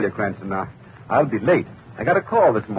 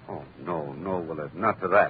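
low, much softer uneasy refusal; frightened protest; 7 semitones lower, 7 dB quieter than usual. Acted. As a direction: pull back from the toast in dread. Cousin refuses the ominous toast.

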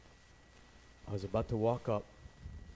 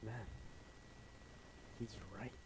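quiet admission under the breath; whispered realization; 17 dB quieter than usual. Retold or imitated. Son realizing his friend was right, whispered. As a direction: whisper it to yourself.

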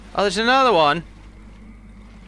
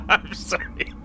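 cracking up mid-performance; laughing too hard to get words out; real laughing fit; 5 semitones higher than usual. Real, not acted. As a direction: break into helpless laughter while apologising. Reader cracks up mid line.

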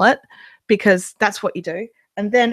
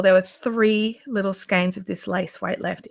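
ordinary talk

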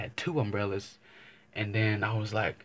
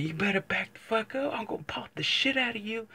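high-pitched retold yell; yelling at the dog; 12 semitones higher than usual. Man scaring off a pit bull in the rain, high mock yell. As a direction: shout it up high to scare off a pit. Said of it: "I'm over here fucking yelling at the dog".

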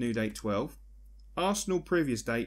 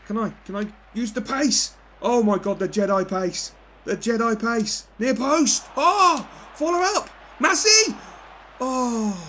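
rising to a high, loud climax; building excited shout; 10 semitones higher, 8 dB louder than usual. Real, not acted. Gamer yelling through a goalmouth scramble, building to a shout. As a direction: build the yell as the chance comes.

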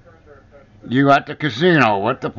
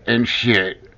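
normal talking voice

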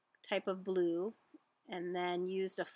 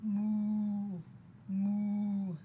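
cow's moo. Acted. Cow calling to the spinning spider, long mooing. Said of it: "said the cow".

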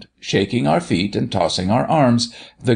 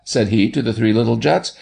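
plain reading voice

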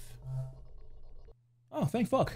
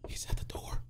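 whisper; 15 dB quieter than usual. Real, not acted.

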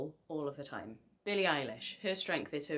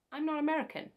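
mock indignant; 12 semitones higher, 3 dB louder, faster than usual. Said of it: "Don't know if you've noticed".

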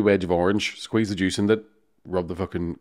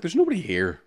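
surprised; 8 semitones higher than usual. Retold or imitated. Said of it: "looking around, like".

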